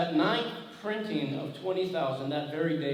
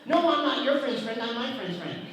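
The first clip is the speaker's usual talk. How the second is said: high, louder comic insistence; mock indignant correction; 8 semitones higher, 5 dB louder than usual. Caller correcting a confused man, mock indignant. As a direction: put the caller straight, playfully indignant. Acted bickering on the phone.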